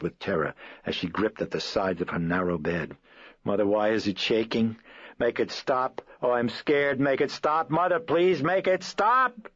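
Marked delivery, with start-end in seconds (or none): 3.4-9.5 higher and louder with each plea; terrified pleading. Acted. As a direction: beg in rising panic. Terrified child on shaking bed.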